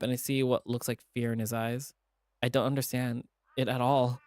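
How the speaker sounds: usual speaking voice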